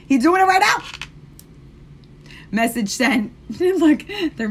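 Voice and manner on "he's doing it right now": much higher, louder eager rise; excited insistence. Real, not acted. Telling viewers the artist is adding them now, excited and loud. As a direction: bounce it up, thrilled he's on it. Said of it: "repeats the line louder".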